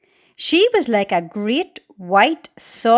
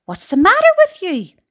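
higher, louder screech; screeching aunt; 9 semitones higher, 5 dB louder than usual. Acted. Cruel aunt screeching at James, high and loud. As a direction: snap at the boy with a screech. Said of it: "Aunt Spiker screeched".

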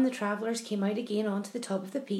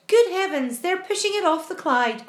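high, raised exclamation; astonished cry; 7 semitones higher, 5 dB louder than usual. A narrator's reading. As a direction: cry out in amazement. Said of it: "cried James".

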